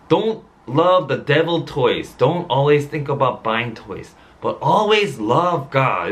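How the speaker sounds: very slow, measured English, word by word; slow solemn quote. Retold or imitated. Pastor quoting God's words to his son, very slow and solemn. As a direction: spell out God's words slowly to the congregation.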